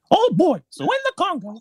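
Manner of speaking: high squeaky cartoon mouse voice; Steamboat Willie voice. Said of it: "in the Steamboat Willie voice".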